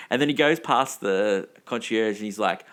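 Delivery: ordinary talk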